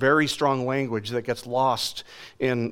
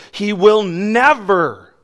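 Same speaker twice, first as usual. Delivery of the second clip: "He will never" slow and louder, each word weighed; emphatic stress; 5 semitones higher, 8 dB louder, slower than usual. Shown as a demonstration. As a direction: lean hard on "never", slow and loud, like it is in bold print. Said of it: "underlined, bold print, big font".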